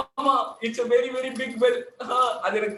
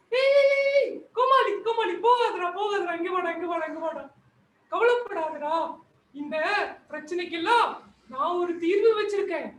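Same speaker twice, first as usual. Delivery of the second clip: granny calling him back; 5 semitones higher than usual. Acted. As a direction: call him back, then coax. Plays granny.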